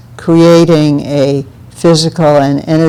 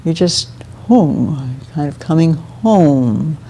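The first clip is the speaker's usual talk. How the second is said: soft, slow and drawn out; gentle grounding; 5 dB quieter, slower than usual. Shown as a demonstration. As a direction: let each key word settle gently, like coming home. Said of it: "a very gently grounding sound".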